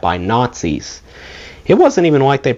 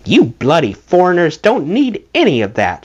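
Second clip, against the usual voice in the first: mock sniffy aunt; 6 semitones higher than usual. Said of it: "she sniffed".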